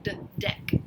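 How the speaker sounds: soft breathy d, d, deck; teacher sounding out a letter. Shown as a demonstration. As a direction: isolate the d before deck. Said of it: "What if I said d".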